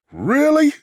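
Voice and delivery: shocked high-pitched really; disbelief. Acted. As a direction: burst out in disbelief. Reacting to shocking sight.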